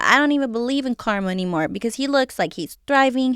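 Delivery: normal talking voice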